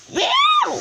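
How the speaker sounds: grumpy high cat yowl; scowling meow. A narrator's reading. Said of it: "scowled Wilbur".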